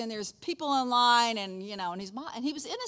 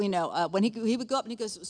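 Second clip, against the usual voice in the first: ordinary delivery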